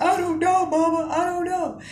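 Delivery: high tearful whine; hammed-up sobby plea; ugly crying voice. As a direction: re-enact wailing helplessly to mom. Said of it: "that ugly ass voice".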